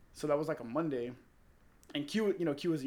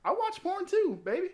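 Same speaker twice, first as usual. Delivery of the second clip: much higher, sing-song mock mom; mom's voice; 5 dB louder than usual. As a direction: play the cool mom, teasing and high. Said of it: "She goes".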